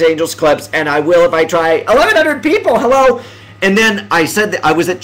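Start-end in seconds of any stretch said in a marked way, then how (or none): 1.9-3.2 high, delighted outburst; excited greeting. Real, not acted. Streamer greets eleven hundred viewers, excited. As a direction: burst out, thrilled at the crowd. Interrupts himself at viewer count.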